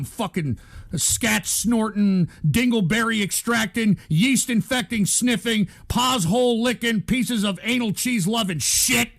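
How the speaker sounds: usual speaking voice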